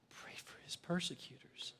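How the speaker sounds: whispered aside; soft whispered sermon line; hushed. Real, not acted. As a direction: drop to a whisper.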